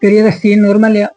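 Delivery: ordinary talk, nothing marked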